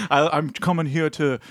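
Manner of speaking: usual speaking voice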